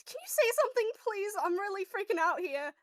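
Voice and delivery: pleading as if freaking out; panicked plea. Acted. Character begging for a reply.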